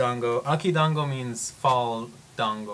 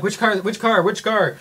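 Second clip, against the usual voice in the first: higher and louder, hurried calling out; urgent firefighters; 8 semitones higher, 8 dB louder than usual. Retold or imitated. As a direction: call it out like a rushed fireman. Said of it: "they're like".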